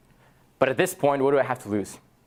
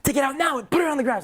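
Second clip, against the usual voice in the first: shouting demand; 14 semitones higher than usual. Retold or imitated. Confronting the laptop thief.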